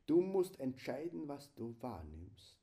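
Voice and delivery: ordinary delivery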